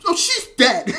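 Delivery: loud, high, cheerful delivery of bad news; happy voice. Friend back from New York reports a death, happy voice. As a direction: imitate a breezy, happy announcement. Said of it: "she says it with, like, a happy voice".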